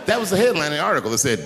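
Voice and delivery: ordinary talk, nothing marked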